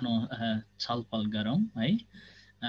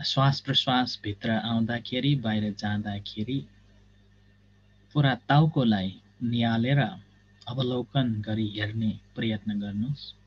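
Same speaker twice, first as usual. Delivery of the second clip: measured instructions with long silences; guided meditation. Real, not acted. Teacher guiding students to observe the body, guided meditation. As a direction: guide them step by step, pausing between phrases.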